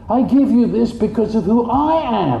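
voicing a loving giver. God telling his child he gives from love, fervent and high. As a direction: pour out love like a delighted father.

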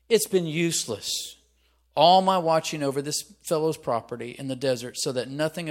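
loud, put-out complaint; frustrated outburst from someone insulted; disgruntled. Acted. David complaining about guarding Nabal's property, disgruntled. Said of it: "disgruntled, frustrated, put out, impatient, insulted".

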